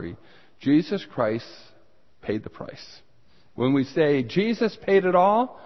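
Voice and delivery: ordinary talk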